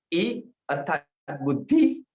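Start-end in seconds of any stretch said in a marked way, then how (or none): 1.4-2.1 बुद्धि with a brief final i; short final vowel. Shown as a demonstration. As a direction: end बुद्धि on one short beat. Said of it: "बुद्धि में हमने छोटी ई रखी". (बुद्धि के अंत में छोटी इ)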